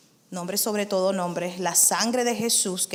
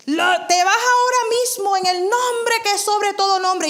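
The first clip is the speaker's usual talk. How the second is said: much higher, loud rebuke; commanding rebuke from within; 9 semitones higher, 5 dB louder than usual. Retold or imitated. Preacher retelling her rebuke of a demon, loud and high. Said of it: "me salió de adentro".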